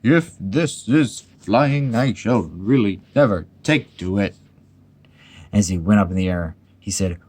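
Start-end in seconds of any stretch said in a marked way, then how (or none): 0.0-4.4 slow, broken word by word; jerky. A narrator's reading. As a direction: jolt each word out as if being bounced. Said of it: "he thought jerkily to himself".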